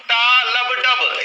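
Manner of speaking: टा लब डब stretched out slowly, beat by beat; slow heartbeat rhythm. Shown as a demonstration. Teacher drills students on the S4 sound, slowly beat by beat. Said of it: "उल्टा कर दो".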